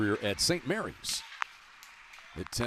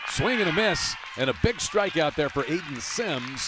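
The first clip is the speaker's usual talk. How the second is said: raised, louder strikeout call, easing into the recap; excited play-by-play; 5 semitones higher, 5 dB louder than usual. Real, not acted. Announcer wraps up the inning after a big strikeout, excited play-by-play. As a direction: punch out the big moment like a radio announcer.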